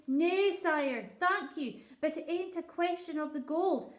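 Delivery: a poor shepherd pleading before his king; rustic old shepherd. Acted.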